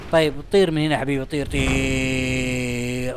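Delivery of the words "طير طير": a long lazy drawn-out shoo; "طير" بمدّ طويل جداً; ممطوطة جداً؛ stretch each word out very slowly. Real, not acted. يطرد الحشرة في اللعبة.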